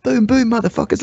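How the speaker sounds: high, rowdy East London geezer; East London accent. Retold or imitated. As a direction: bark it like an East London street kid. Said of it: "East London accent".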